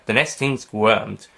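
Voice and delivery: ordinary delivery, nothing marked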